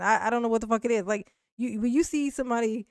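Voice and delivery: ordinary delivery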